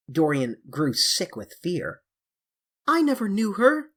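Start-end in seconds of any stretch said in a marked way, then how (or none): 2.9-3.9 high, loud frightened stammer. A narrator's reading. Dorian stammering his denial to James Vane. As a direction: stumble out the denial in fear. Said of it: "he stammered".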